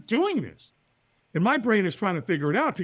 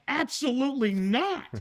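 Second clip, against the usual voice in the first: high, incredulous no; emphatic refusal; 9 semitones higher than usual. Retold or imitated. Invited to walk on fire, emphatic refusal. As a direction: shut the idea down in mock horror.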